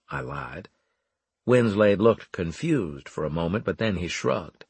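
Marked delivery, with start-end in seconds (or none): none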